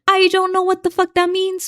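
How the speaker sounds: playful British accent attempt. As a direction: try out a British accent on a streamer's aside. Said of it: "So I'm about to sing in a British accent".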